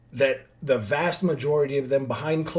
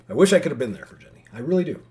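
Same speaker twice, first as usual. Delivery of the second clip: emotional; 8 dB louder, faster than usual. Real, not acted. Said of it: "It's an emotional one".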